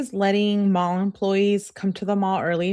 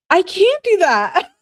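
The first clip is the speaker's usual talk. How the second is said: loud, much higher squeal; high-pitched protest; 12 semitones higher, 8 dB louder than usual. Real, not acted. Squealed protest at accent request.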